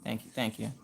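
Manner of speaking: soft, low under-the-breath thanks; muttered thanks. Retold or imitated. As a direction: whisper thanks so nobody hears. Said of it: "I was like".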